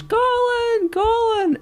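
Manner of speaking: very high, far-off calling voice of his mum. Retold or imitated. Storyteller voicing his mum calling out to him. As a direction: call his name like a mum hidden in the dark.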